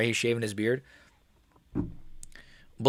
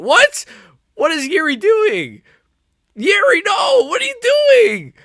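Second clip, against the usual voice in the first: shocked shout; 9 dB louder, slower than usual. Real, not acted. Yuri stands and fights, disbelief.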